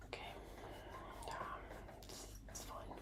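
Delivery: whispered resigned aside; whispering. Real, not acted. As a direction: breathe it out under your breath.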